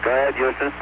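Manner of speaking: astronaut radio. Real, not acted. Crew answers over radio.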